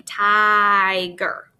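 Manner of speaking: "tiger" with the first vowel held as a long eye; drawn-out long I. Shown as a demonstration. Teacher showing pupils the I says its name, drawn out. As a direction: stretch the I in "tiger", then add ger. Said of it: "notice how this I says its name".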